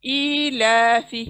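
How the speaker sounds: slow, drawn-out ईलाफी with the long vowel held; slow tajweed reading of ईलाफी; Quran recitation drill. Shown as a demonstration. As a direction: stretch ईलाफी slowly, cutting off फी. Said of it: "वहाँ पर आवाज़ को छोड़ देना है".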